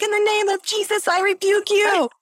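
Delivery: high, panicked prayer shout; frantic rebuking. Retold or imitated. Tripping wife rebukes a ghost, panicked and high. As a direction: cast out the ghost in terror.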